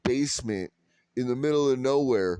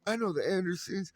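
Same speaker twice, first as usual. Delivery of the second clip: high, chirpy mock of a gushing woman; high mock voice of the woman at the bar; 6 semitones higher than usual. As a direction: squeal it like an overfriendly stranger. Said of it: "And she".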